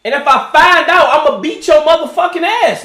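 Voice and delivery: usual speaking voice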